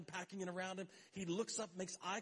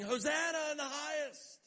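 high, loud cheer of praise; acted crowd acclamation; 11 semitones higher, 6 dB louder than usual. Preacher voices the crowd hailing Jesus entering Jerusalem, loud acclamation.

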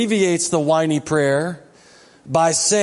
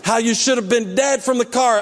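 ordinary talk

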